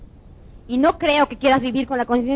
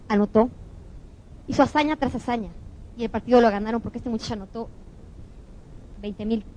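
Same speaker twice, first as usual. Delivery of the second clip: ordinary talk, nothing marked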